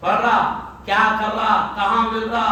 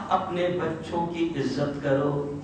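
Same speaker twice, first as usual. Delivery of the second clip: slow, softer gentle advice; gentle counsel; 4 semitones lower, 6 dB quieter, much slower than usual. Acted. Preacher gives the Prophet's advice to parents, slow and gentle. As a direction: say it slowly like tender counsel. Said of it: "پیارے نبی نے کہا".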